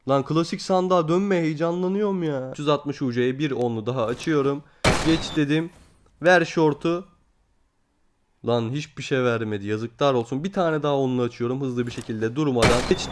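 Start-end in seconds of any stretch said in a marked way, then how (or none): none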